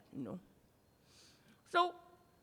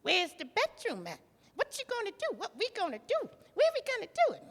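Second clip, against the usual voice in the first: nasty-talking bride; 11 semitones higher than usual. Retold or imitated. Bride demands the bedroom from her husband, high nasty talk. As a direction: imitate her pestering in a high pitch. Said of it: "talked to her husband real nasty".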